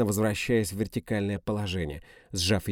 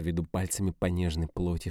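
ordinary delivery, nothing marked

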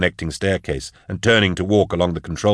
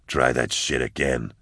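low, softer voice, thinking to himself; inward thought; 7 semitones lower, 6 dB quieter than usual. A narrator's reading. Fighter silently daring his attacker, low muttered thought. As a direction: keep it down, a private dare. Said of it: "he thought".

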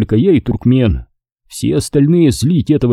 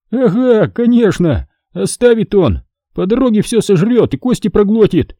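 a raised voice mocking a glutton; sarcastic jab; 10 semitones higher than usual. A narrator's reading. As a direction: keep needling him. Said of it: "не унимался Хасан".

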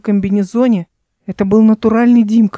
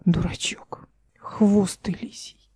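slow, soft unvoiced whisper; tender whisper; 9 dB quieter, slower than usual. Эл tenderly whispers to Дмитрий while wiping his tear. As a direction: whisper it fondly, slowly.

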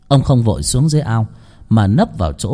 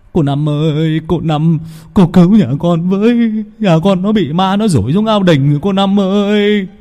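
loud, higher wailing plea; pleading lament; 7 semitones higher, 3 dB louder than usual. A narrator's reading. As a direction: beg the shaman for help. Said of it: "tế như tế sao kể lể". (kể lể van xin thảm thiết)

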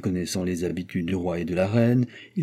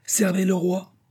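raised, high call to announce the king; exclaimed call; 9 semitones higher, slower than usual. A narrator's reading. Servant calls out to announce the king, raised high. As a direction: cry out the welcome for the arriving king. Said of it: "Weber s'écria".

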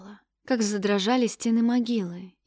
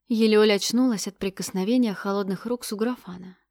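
plain reading voice

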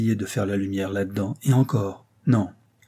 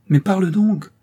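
high, loud, at the end of his strength; exhausted outcry; 9 semitones higher, 5 dB louder than usual. Acted. André at the end of his strength, crying out to his friend. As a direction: cry out, worn down and begging. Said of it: "s'écria André à bout de force".

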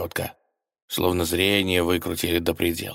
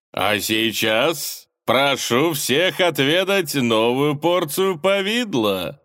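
showy villain host; 8 semitones higher, 5 dB louder, slower than usual. Acted. Villain Palkovich announces to guests.

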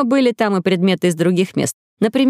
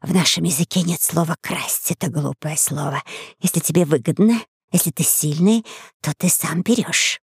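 a cool little dragon, quiet and sure; lizard voice; 4 dB quieter than usual. A narrator's reading. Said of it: "возразила одна из ящериц".

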